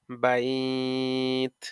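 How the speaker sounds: বাইত with a very long held glide before the final t; two-alif madd lin. Shown as a demonstration. Teacher demonstrating madd lin, glide held very long. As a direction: hold "baai" on one tone, then close বাইত. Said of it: "তাকে দুই আলিফ মাদে লিন কয়".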